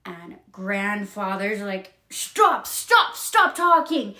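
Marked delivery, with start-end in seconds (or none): 2.1-4.1 loud, high, punched acted outburst; angry shout. Grandfather silences Felicity's story, acted angry shouting. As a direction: cut her off with repeated loud bursts. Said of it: "He is so angry".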